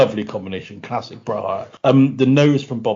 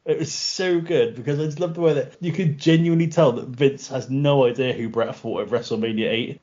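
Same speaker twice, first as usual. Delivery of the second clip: normal talking voice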